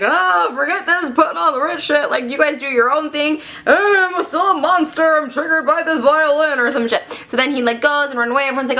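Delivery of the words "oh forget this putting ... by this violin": slow, drawn-out monster complaint. Acted. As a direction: moan it like a put-upon monster. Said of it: "Monster's like".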